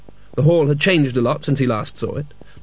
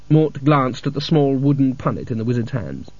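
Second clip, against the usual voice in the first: usual speaking voice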